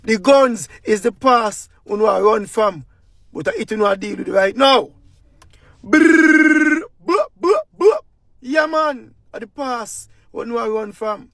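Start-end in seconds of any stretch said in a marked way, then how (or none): 5.8-8.0 rolling "brrr" then sharp high "buh" bursts; gunfire noise. Retold or imitated. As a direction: mouth a machine gun burst. Imitating gunfire in political rant.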